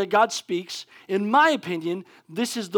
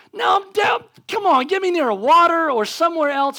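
high, raised-voice mock alarm; acted panic; 6 semitones higher, 4 dB louder than usual. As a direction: play desperate to get away from fire. Acting panic at the fire.

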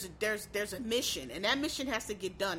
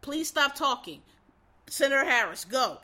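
firm moderator; 5 dB louder than usual. Shown as a demonstration. Moderator firmly cutting off the vice president. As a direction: shut him down with authority. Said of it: "What you need to say is".

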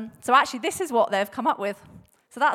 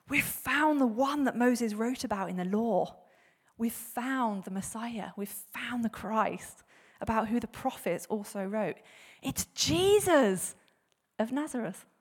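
slow, drawn-out reveal; momentous announcement; 4 dB quieter, slower than usual. Acted. Philip telling his friend about the Messiah, slow momentous announcement. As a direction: stretch the big news out slowly.

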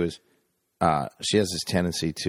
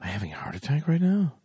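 paranoid; 6 semitones higher, faster than usual. Retold or imitated. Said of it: "be like".

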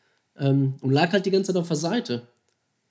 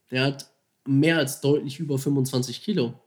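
ordinary talk

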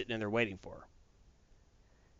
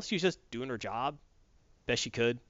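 usual speaking voice

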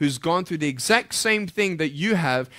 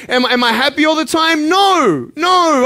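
ordinary talk

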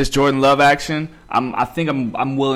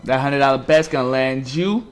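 usual speaking voice

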